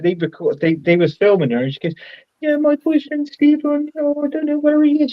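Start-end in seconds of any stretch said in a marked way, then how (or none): 2.4-5.1 mock crying. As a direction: imitate a tearful drunk girl looking for her boyfriend. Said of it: "She's sort of really upset, crying".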